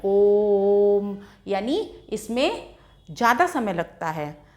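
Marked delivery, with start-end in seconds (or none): 0.0-1.2 ओम stretched very slowly, humming the m; prolonged om. Shown as a demonstration. Teacher showing children a pluta vowel, slow chant. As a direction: chant ओम three times as long. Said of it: "कैसे उच्चारण होता है".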